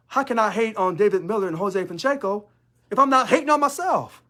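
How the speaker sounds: rising outrage. Real, not acted. Union leader challenging his critics, rising indignantly. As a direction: throw the question back at them, climbing.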